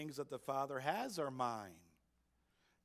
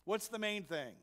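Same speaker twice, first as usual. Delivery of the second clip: normal talking voice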